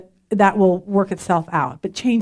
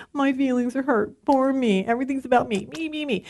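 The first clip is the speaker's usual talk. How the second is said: small, soft poor-me voice; hushed, hammed-up pouting; mock self-pity; 6 dB quieter than usual. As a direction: play the wounded victim, quietly. Mocks her own self-pity.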